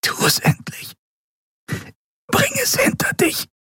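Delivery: strained, choked whisper; faint, breathy urging. A narrator's reading. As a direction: whisper a plea to end it. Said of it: "flüsterte sie".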